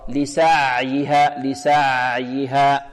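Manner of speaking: very slow لسعيها, the ع held between tight and loose; medium ayn. Shown as a demonstration. Teacher modelling the correct way, لسعيها with balanced ayn. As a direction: hold the ع of لسعيها briefly without choking it. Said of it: "الصحيح أنها وسط".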